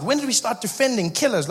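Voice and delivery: ordinary delivery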